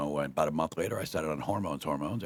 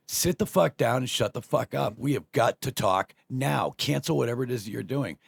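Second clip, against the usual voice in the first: retold stern demand; 5 semitones higher, 5 dB louder than usual. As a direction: lay down an ultimatum, imitating an angry wife. Retold wife's furious ultimatum.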